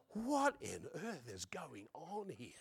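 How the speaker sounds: slow, very soft disbelief; hushed bewilderment. Retold or imitated. Young convert watching the preacher, hushed bewilderment. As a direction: murmur it slowly, utterly baffled. Recalls bafflement at the exorcism.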